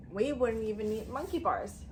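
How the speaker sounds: usual speaking voice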